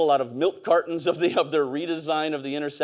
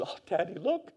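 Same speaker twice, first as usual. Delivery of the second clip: daughter's voice; 4 semitones higher, 7 dB quieter than usual. Retold or imitated. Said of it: "and she".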